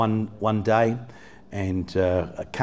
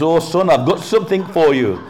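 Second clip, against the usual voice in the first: high, loud imitation of a British man; British accent; 6 semitones higher, 7 dB louder than usual. British guy offers the boy a package, in an imitated British accent. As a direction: put on a British stranger's voice, raised and loud. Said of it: "it was a British guy".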